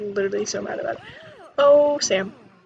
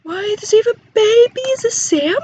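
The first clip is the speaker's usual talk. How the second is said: higher and louder, stunned; loud surprise; 9 semitones higher, 8 dB louder than usual. Real, not acted. Player shocked that a Sim has a baby, loud and high. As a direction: blurt it out in disbelief.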